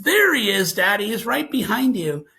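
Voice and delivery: put-on urgent little-kid alert; alarmed child. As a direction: imitate a kid pointing out danger. Child spots intruder behind father.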